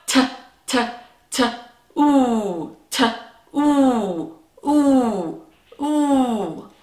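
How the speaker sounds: repeated "t" then long "ooh"; sounding out. Shown as a demonstration. Teacher stretching the last sound of two, drawn out. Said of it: "figure out the last part of our word".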